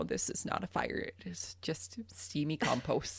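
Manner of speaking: whispered, voiceless aside; whispered to herself. Retold or imitated. Farmer quietly telling herself the steam is compost, whispered. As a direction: breathe it out as a private thought. Quoting her own thought to herself.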